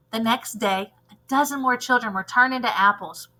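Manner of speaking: ordinary delivery, nothing marked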